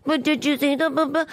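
comic sends up reporters with fake concern; mock sympathetic. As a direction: parody the sympathetic press question. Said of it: "sympathy in your voice".

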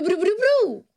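high, rising trumpeting imitation; improvised elephant noise. Contestant inventing the elephant's call, high silly trumpeting. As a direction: trill it upward like a silly elephant.

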